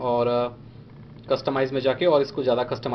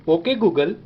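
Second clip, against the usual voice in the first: voice command; 3 dB louder, much slower than usual. Shown as a demonstration. Demonstrating wake phrase to phone.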